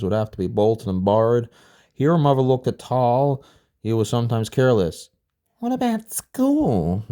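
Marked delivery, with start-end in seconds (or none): 5.6-7.0 put-on high, slow small child's voice; a child explaining earnestly in a high voice. A narrator's reading. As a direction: speak up high like a little kid. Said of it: "Tal asked".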